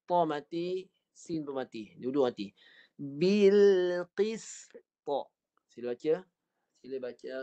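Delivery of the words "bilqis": Bilqis recited again, ta and sin both stopped; teacher chants Bilqis with both letters dead; recited demo. Shown as a demonstration. Teacher repeating for students, recited. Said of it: "Dengar saya baca yang ta".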